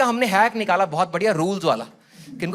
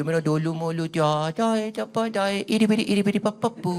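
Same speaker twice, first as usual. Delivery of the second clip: put-on soft lisping coo to a baby; aunt cooing nonsense at an infant; baby talk; 2 semitones lower, 5 dB quieter than usual. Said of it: "इस तोतली tone में".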